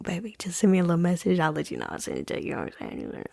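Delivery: fast playful whisper. Real, not acted. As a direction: whisper the offer like a secret. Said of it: "it's just more fun to whisper like that".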